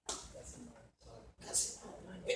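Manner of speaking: very soft, slow aside; whispered answer. Acted. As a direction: breathe it out. Rhetorical answer dropped low. (respuesta susurrada, lenta)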